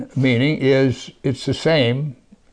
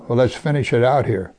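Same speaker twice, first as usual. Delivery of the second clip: usual speaking voice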